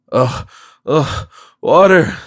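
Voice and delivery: groan, then a loud rasping cry for water; groaning plea. A narrator's reading. Parched miser groaning at the stranger for water. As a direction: croak it out, parched.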